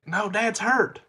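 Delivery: put-on high-pitched little kid protest; mock small child's plea; imitated worried child. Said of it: "And then she's like".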